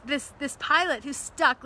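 ordinary delivery

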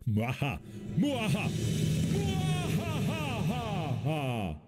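hammed-up villain laugh; slow mock evil cackle; mustache twirling villain. Villain laugh after cashier line.

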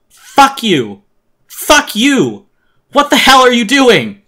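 high, very loud outburst, climbing in pitch; furious scream. Acted. Boy to the foe he just axed, furious screaming. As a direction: scream it at him in shocked fury.

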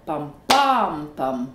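"pam, PAM, pam", middle beat long and strong; stress on middle beat. Shown as a demonstration. Teacher showing three-syllable rhythm, "pam, PAM, pam" stressed in the middle. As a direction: hammer the second pam, drop the last one low. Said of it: "se crée un rythme différent".

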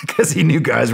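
chuckling as the words start; laughing. Real, not acted. Storyteller explaining the retention joke, laughing. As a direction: explain it through a real laugh.

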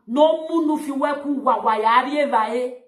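usual speaking voice

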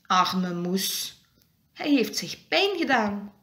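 ordinary delivery

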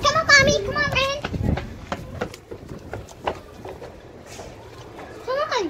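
calling to family ahead, high. Real, not acted. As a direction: shout come on across the park.

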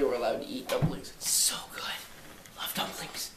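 hushed whisper, savoring the thought. Real, not acted. Grandson dreamily confides love of dumplings, whispered. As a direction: whisper it like a delicious secret. Secret joy about dumplings.

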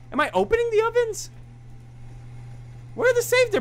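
loud, high panicked squeal; high-pitched panic. Real, not acted. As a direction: squeal it in alarm. Trapped in oven.

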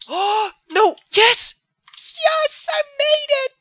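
high, loud yells of triumph; real delighted shriek; ecstatic shriek. Real, not acted. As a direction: scream with joy at surviving. Survives a near death in game.

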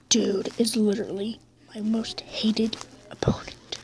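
soft whispered grudge. Real, not acted. Player whispers about the hated opponent. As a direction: whisper it like a secret.

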